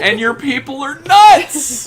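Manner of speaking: high, loud, drawn-out outburst; shouted comeback. Real, not acted. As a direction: yell it in mock outrage, stretching NUTS. Shouted comeback in mock argument.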